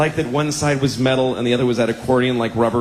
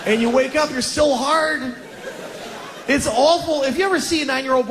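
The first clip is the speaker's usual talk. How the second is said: anguished whine; 11 semitones higher than usual. Acted. Comic anguish over boyhood.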